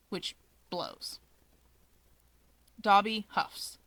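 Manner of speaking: ordinary delivery, nothing marked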